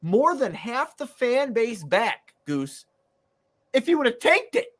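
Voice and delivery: high-pitched, worked-up ranting; incredulous, high-pitched fan outrage; exasperated rant. Real, not acted. Worked-up rant about the team's leader.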